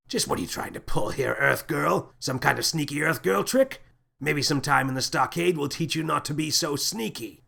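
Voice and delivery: a lightly voiced gruff captain, accusing; suspicious scowling captain. Captain accuses Earth Girl of a trick, suspicious. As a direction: needle her with mock suspicion. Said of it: "The captain scowled".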